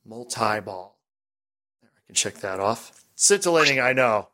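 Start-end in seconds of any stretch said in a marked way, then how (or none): 0.0-1.0 soft "multi-ball" with a long I; "multi-ball" with "tie" in the middle. Shown as a demonstration. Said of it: "using the I".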